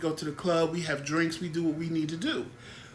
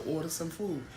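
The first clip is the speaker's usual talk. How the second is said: hushed, under the breath; quiet sideways hint; out the side of the mouth; 7 dB quieter than usual. Retold or imitated. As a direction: murmur it so the table can't hear. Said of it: "I said it out the side of my".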